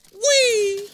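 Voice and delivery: high, loud squeal of play; long "whee" as if flung through air; gleeful whee. Real, not acted. Player slingshots a figure, gleeful high squeal.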